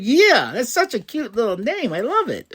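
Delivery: high, cutesy squeal of approval; gushing delight. Retold or imitated. Fan delighted by the name Rio, high gushing. Gushes over a cute team name.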